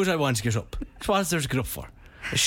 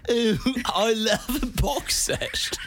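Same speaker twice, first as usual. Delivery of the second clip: slow, high, drawn-out ooh; teasing people who say box set, slow high swoon; mock gushing; 7 semitones higher, much slower than usual. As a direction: swoon over box sets. Mocking box set fans.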